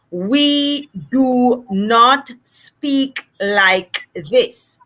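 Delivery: very slow, word by separate word; enunciating every word. Shown as a demonstration. Therapist showing how we don't speak, over-enunciated. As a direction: separate and fully sound out each word. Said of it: "That's me enunciating every word".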